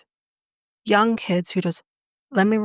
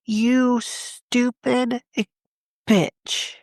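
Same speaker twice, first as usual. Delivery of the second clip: drawn-out, higher-pitched angry insult; slow contemptuous insult; 5 semitones higher, much slower than usual. Real, not acted. Telling the listener about the killer, slow angry insult. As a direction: spit the words out slowly in disgust.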